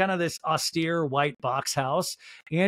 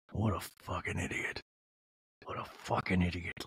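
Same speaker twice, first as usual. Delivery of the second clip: low, softer mock macho voice; very manly; 11 semitones lower, 10 dB quieter than usual. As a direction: play a gruff tough guy, dropping it low. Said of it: "Like, very manly".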